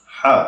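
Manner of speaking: short, quick হা; unstretched. Shown as a demonstration. Teacher showing the vowel has no stretch, short হা. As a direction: cut হা short without drawing it out. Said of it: "তখন কিন্তু টান হবে না".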